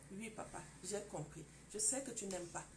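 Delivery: soft, submissive answer; calm him down with a quiet, yielding voice; meek wife. Acted. Wife answers the angry husband. (épouse docile qui répond doucement à son mari)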